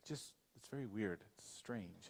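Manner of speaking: soft, low uneasy murmur; uneasy remark. Retold or imitated. Guest verbalizing his discomfort in the house, soft unease. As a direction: let it fade low and uncomfortable. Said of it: "showing signs of obvious discomfort".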